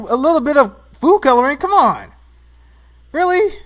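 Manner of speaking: high, loud disbelief; indignant rant. Real, not acted. Mocking the slackers' food-coloring drink, loud and incredulous. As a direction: scoff at it, incredulous. Ranting about slackers' Kool-Aid.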